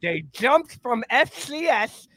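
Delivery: Lou Holtz. Acted. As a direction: grandly praise the team in a comic coach's voice. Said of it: "that Lou Holtz praising James Madison".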